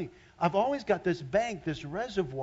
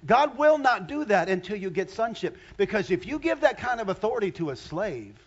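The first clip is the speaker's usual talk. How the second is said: raised, high preaching voice; forceful preaching; 5 semitones higher, 7 dB louder than usual. Acted. Pastor to congregation, preaching at full force. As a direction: drive the point home to the church.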